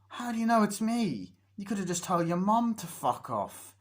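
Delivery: loud, put-on outrage; mock indignation. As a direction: call back offended through the door. Indignant reply at the door, retold.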